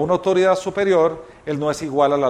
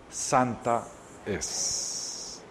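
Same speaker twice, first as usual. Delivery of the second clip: hushed whisper; 4 semitones lower, 12 dB quieter, much slower than usual. Acted. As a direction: drop to a reverent whisper. God speaks holy ground to Moses.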